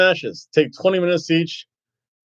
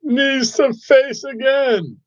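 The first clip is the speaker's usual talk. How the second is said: excited shout; 8 semitones higher, much slower than usual. Real, not acted. Live wrestling match commentary.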